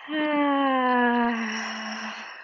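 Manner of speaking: slow audible breath out; guided breathing exhale; long deep exhale. Shown as a demonstration. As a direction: let a deep breath go slowly. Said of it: "let's take a few deep, deep breaths together".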